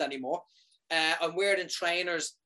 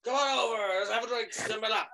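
higher, sing-song Santa invitation; jolly Santa pitch; 7 semitones higher than usual. Retold or imitated. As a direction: lure them over like a party Santa. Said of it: "I'm Santa Claus".

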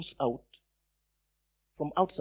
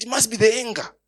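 higher, louder exclamation; sudden realisation; 8 semitones higher, 7 dB louder than usual. Retold or imitated. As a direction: blurt it out as it dawns on you. Reenacts his sudden realisation about George.

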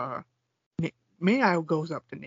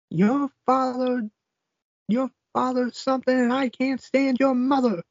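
mock yelling; 7 semitones higher than usual. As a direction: yell it at him like a fed-up wife. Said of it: "yelling at".

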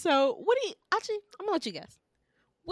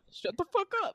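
laughing mock taunt; 11 semitones higher than usual. Echoing bro taunt.

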